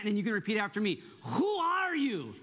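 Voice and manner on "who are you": high, drawn-out Princess Bride accent. Shown as a demonstration. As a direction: mimic the Princess Bride accent slowly for repeating. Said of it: "I'm gonna say it with that accent".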